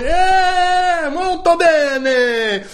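high, stretched-out comic Italian exclamation; mock Italian. Disciple bursts into another language, hammed-up Italian. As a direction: sing out a hammed-up Italian accent. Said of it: "do you ever talk like that".

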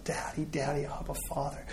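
whispered anguished plea to the Father; barely voiced, hushed call of Abba; crying out. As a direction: Son calling on his father in a hushed whisper. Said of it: "as his Son cries out".

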